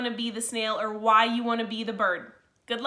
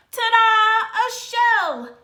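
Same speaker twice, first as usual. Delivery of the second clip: much higher, much louder big reveal; triumphant ta-da; 11 semitones higher, 11 dB louder, much slower than usual. Acted. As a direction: present it like a magic trick. Norman shows his new shell.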